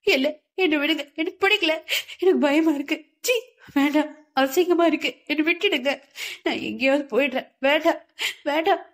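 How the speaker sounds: terrified shrieking. A narrator's reading. Sindhu screaming again in her nightmare, high terrified pleading. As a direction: plead in disgust and fear, rushing. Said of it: "மீண்டும் அலறினாள் அவள்".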